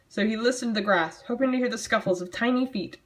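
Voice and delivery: ordinary talk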